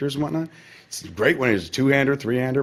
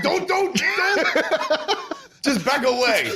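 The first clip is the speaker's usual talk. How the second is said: high, shouted don't, don't, don't, then a laugh; mock panic; much slower than usual. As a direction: warn everyone off in mock alarm. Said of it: "It was like".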